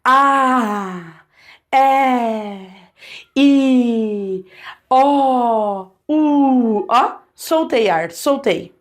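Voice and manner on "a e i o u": each vowel held long and open; drawn-out vowels. Shown as a demonstration. Teacher drawing out the vowels for the class. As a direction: sing out every vowel, letting air flow. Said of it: "fala comigo".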